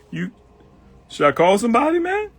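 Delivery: high, loud mock alarmed buddy; awkward homeboy. Friend unsure what to do, high mock alarm. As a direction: sound lost and a bit panicked. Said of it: "He don't know what to do".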